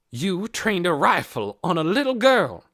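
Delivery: loud, high furious accusation; breathless fury. A narrator's reading. Missus Beeson accusing Hoyt, breathless and furious. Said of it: "breathless, furious voice".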